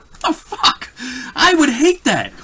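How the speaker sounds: high, loud disbelieving outburst; incredulous outburst. Real, not acted. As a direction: squeak it out in disbelief. Mock outrage at losing powers.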